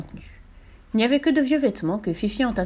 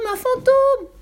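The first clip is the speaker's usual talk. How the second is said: much higher, louder falling scream; terrified scream; 6 dB louder, slower than usual. A narrator's reading. Annika joining Tommy's ghost panic, louder falling scream. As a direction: scream it at full fright. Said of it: "bientôt imité par Annika".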